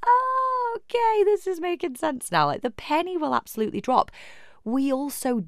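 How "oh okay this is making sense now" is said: dawning realisation. Acted. Coach voicing a listener finally getting it, dawning realisation. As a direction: voice someone suddenly getting it.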